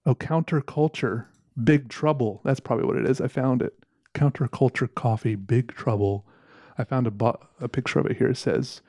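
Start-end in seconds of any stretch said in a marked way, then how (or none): none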